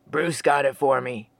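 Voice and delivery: low, easygoing Sasquatch voice; lightly voiced laid-back Bigfoot. A narrator's reading. Sasquatch replies.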